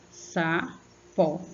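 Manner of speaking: "sapó" split into sa, pó; two separate syllables. Shown as a demonstration. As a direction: sound out "sapó" in two spaced beats. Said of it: "Você abriu a boca duas vezes". ("sapó" em duas sílabas separadas: sa-pó)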